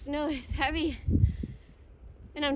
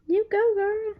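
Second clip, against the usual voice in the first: cheering; 6 semitones higher than usual. Acted. Echoing cheer loudly.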